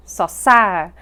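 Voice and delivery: "sosar" with a loud, weighted "sar"; second syllable accented. Shown as a demonstration. Teacher restating the correct form, stress on second syllable. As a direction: land firmly on "sar" in "sosar". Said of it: "has had the second syllable accented".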